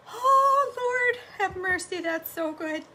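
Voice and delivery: delighted exclamation. Real, not acted. Savoring a delicious bite.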